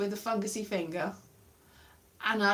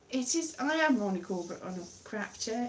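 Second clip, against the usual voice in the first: ordinary talk, nothing marked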